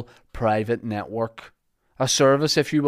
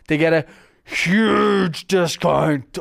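hammy stage actor; 5 semitones higher, 5 dB louder, much slower than usual. Pretend. Hammy slow ad read.

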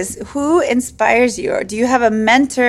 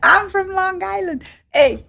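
higher, slow mock Long Island accent; playful tough-guy New York accent; 10 semitones higher, slower than usual. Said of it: "you should be able to do it".

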